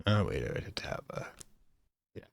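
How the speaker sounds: hushed, breathy whisper to himself; real whisper aside. Real, not acted. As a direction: drop to a murmur under the breath.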